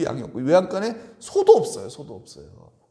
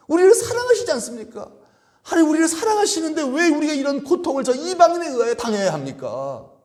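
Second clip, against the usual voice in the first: much higher, louder pleading protest; desperate, higher and louder appeal; anguished outcry; 13 semitones higher, 4 dB louder than usual. Pretend. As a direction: cry out to God in bitter disbelief. Habakkuk crying out to God.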